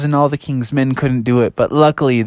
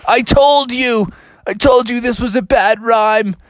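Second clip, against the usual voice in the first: protesting egg; 10 semitones higher than usual. Acted. Shattered egg blames the class, high mock-wailing protest. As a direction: wail a mock reproach. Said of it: "And it's like".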